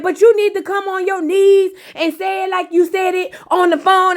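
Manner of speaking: Shay insisting he grovel, in a high mock voice; high mock demand. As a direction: demand he beg on his knees, hammed up.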